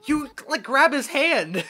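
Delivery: urgent call. Real, not acted. Urging character to grab hand.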